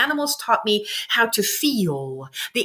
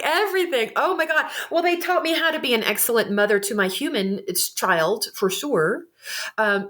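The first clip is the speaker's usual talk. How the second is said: ordinary delivery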